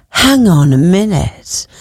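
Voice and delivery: slow, faint Catherine Zeta-Jones Welsh lilt. Shown as a demonstration. [name] trying to get her Catherine Zeta-Jones voice back, slow and lightly done. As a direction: stretch "hang on a minute" out, slow, reaching for her Welsh lilt. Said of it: "I couldn't get it back".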